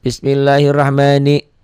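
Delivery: Bismillahirrahmani recited slowly, "ma" held, "ni" kept short. Shown as a demonstration. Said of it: "ni-nya juga ko".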